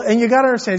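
ordinary delivery

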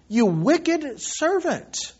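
high, slow, drawn-out rebuke; indignant condemnation; angry master. Acted. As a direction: denounce the servant, stretching the words. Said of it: "his master was angry".

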